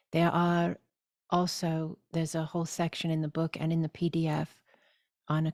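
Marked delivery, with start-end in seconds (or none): none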